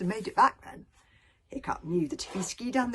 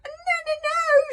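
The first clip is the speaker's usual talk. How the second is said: much higher, wailing dragon scream; 8 dB louder than usual. A narrator's reading. Toothless pleading with Hiccup, screaming. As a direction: scream it in panic. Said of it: "screamed Toothless".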